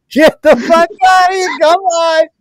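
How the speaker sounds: high squealing laugh through the words; can barely get the words out for laughing; laughing hard. Real, not acted. Host cracks up at his co-host's story, wheezing laughter.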